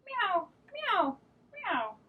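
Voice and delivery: high meows; cat meowing. Acted. Cat answering the dog with high meows. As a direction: meow like a little cat.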